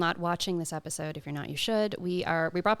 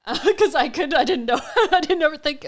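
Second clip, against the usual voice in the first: laughing; 8 semitones higher, 8 dB louder than usual. Real, not acted. Laughing at own snack habits.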